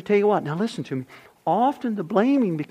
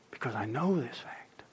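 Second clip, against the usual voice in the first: soft breathy confession; hushed whisper; 5 semitones lower, 11 dB quieter than usual. Real, not acted. Pastor whispers a truth to his listeners. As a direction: whisper it like a secret. Quiet aside to congregation.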